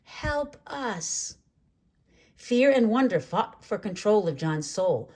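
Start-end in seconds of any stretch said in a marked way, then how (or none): 0.0-1.4 high, soft plea; one clear small voice asking for help; bright single voice. A narrator's reading. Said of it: "a single bright voice that said".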